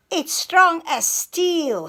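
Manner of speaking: high, slow boastful brag; bragging. Acted. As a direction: show off proudly. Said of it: "he bragged".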